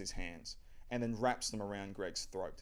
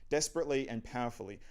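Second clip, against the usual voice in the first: ordinary talk, nothing marked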